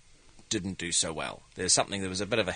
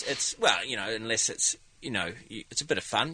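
ordinary talk, nothing marked

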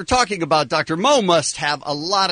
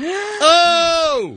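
high, long drawn-out "oh!"; horrified outcry; 14 semitones higher, 3 dB louder than usual. Real, not acted. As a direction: cry out in disgusted shock. Horrified reaction to cockroach story.

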